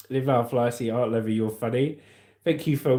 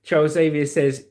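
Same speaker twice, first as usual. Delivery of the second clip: ordinary talk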